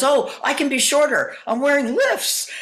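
high, pleading actor; eager actor voice. Retold or imitated. Eager actor voiced in Tootsie scene.

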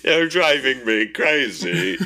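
high, loud, slow plea through laughter; laughing mock plea. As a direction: overplay the exasperated victim. Victim begging villain.

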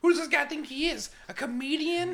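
high, incredulous put-on voice; mock heckler.

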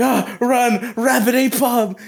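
high, slow, frightened cry of warning; panicked yelp. Retold or imitated. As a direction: yell it like you're running for your life. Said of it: "He's like".

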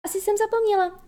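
put-on high, light, careless child's reply; offhand. A narrator's reading. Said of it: "řekla Wendy ledabyle".

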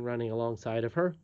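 ordinary delivery, nothing marked

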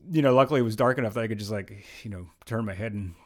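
ordinary talk

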